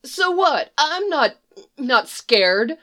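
put-on high, protesting boyish voice; defiant denial. The boy insists to the horse he's not scared, high and defensive.

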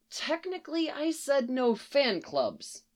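high, soft, drawn-out quibble; meek, squeaky technicality; sheepish excuse. A narrator's reading. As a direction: offer a feeble excuse in a high small voice. Barry sheepishly excuses himself, head dropped.

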